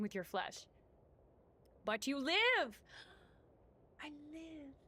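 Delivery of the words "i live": small, soft, higher voice; feeble survivor. Pretend. Player answering that they survived a fall, feeble soft voice. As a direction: squeak it out weakly, as if just surviving.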